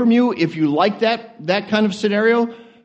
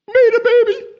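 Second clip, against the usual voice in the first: excited exclamation; 4 dB louder than usual. Real, not acted.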